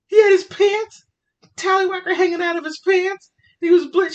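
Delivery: very high, loud squeal of disbelief; incredulous echo. Retold or imitated. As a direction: mimic a friend repeating it, aghast. Friend echoes story in disbelief.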